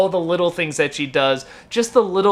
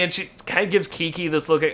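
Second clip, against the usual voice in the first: ordinary talk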